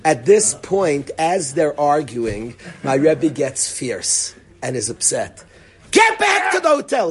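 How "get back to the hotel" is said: high furious yell at the student; a teacher losing his temper at a stubborn student, shouting; explosive shout. Retold or imitated. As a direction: blow up and bellow the order. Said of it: "He, like, explodes".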